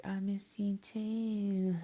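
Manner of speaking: high, slow, whispery squeak; mock tearful. Anna answering Shelly's reunion, mock tearful. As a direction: draw it out in a high, breath-only whimper.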